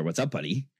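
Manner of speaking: normal talking voice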